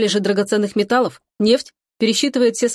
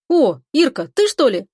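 higher-pitched startled question; surprised; 5 semitones higher, 4 dB louder than usual. A narrator's reading. Brother finding Ira on the phone, surprised. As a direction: caught off guard by who is on the line. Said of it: "удивлённый голос брата".

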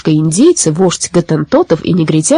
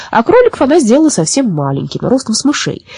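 plain reading voice

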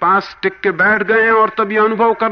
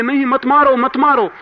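retold pleading shout, higher and louder; crying out; 8 semitones higher, 5 dB louder than usual. Mystic clutching his back cries out, retold loud plea. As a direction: call out twice to stop the beating. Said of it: "चिल्लाने लगे".

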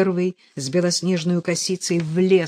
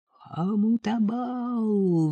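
long drawn-out, higher ritual call; priest's invocation; 5 semitones higher, much slower than usual. A narrator's reading. Priest invoking in a sacrificial rite, drawn-out call. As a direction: intone the name slowly, letting it fall. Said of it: "взывает жрец".

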